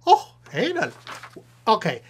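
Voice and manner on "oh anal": high, perked-up squeal of interest; delighted surprise. Acted. Puppet host perking up at the letter's topic, high delighted squeal. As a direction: jump up in pitch, suddenly very interested.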